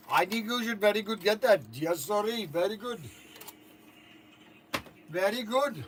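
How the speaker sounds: put-on Indian accent; Indian-accented salesman's patter. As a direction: sell it in a mock Indian accent. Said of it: "I'm going to do my Indian accent for TikTok".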